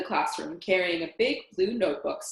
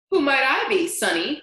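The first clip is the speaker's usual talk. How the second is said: put-on old granny cackle; cackling grandmother; 6 semitones higher, 7 dB louder than usual. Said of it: "Paul cackled".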